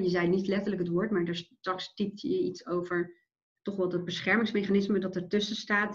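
ordinary delivery

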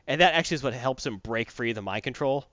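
ordinary talk, nothing marked

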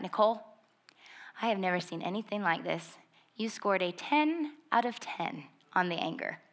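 hushed, low, measured pronouncement; grave quiet verdict. Retold or imitated. Counselor telling Nicole her anger score, hushed and grave. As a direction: lean in and break the news softly.

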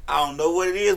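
ordinary talk, nothing marked

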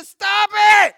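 full, high shout; yelled. Retold or imitated. Samuel yelling at Saul in his sin, full shout. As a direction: bark it like a prophet out of patience. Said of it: "He yelled at him".

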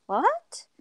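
high, rising disbelief; astonished. Retold or imitated. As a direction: act out being taken aback. Retold shock at the doctor's news.

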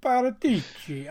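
Paratici with rolled Italian flair, high and loud; Italian accent. Shown as a demonstration. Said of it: "I have accent Tourette's".

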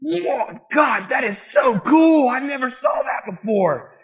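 high, acted shout of wonder; hammed-up amazed revelation; awestruck discovery. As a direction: cry out as the light bulb comes on. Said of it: "the light bulb comes on and you go".

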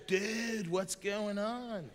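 turtle dad voice. Retold or imitated. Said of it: "And his dad goes".

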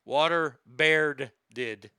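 Water beared did drawn out slowly, each part separated; Water beared did spoken slowly to land the pun; slow pun delivery. Shown as a demonstration. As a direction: spell out the pun word by word, slowly. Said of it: "Get it?".

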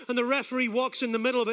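ordinary talk

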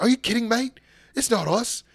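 mock British wrestlers. Host voicing the GYV in a light British accent. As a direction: toss it off like a cheeky Brit. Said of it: "they're like".

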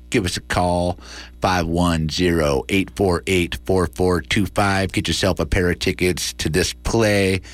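ordinary delivery

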